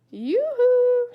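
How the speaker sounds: high, loud sing-song yoo-hoo; calling out. A narrator's reading. Neighbour at the back door calling a cheery yoo-hoo. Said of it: "someone called out".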